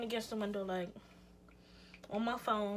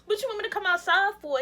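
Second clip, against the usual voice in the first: outraged, shouting at the ex; indignant outburst; 9 semitones higher, 6 dB louder than usual. Retold or imitated. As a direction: fire it back at him in disbelief. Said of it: "That's exactly how I was".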